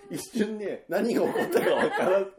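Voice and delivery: talking through laughter; giggling while explaining; laughing. Real, not acted. Laughing while describing Mukku.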